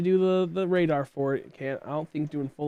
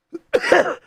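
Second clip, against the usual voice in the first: sudden sharp cough, pitched up; high hacking cough; 8 dB louder than usual. Real, not acted. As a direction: hack out a real cough. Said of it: "Sorry, I'm coughing".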